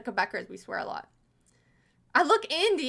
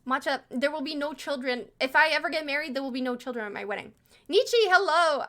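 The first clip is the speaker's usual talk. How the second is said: normal talking voice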